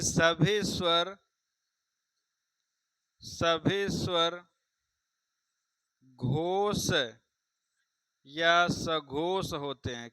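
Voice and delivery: very slow, with long pauses between phrases; slow dictation. Shown as a demonstration. Teacher dictates a rule about vowels, slow dictation. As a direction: dictate it piece by piece, stretching each word.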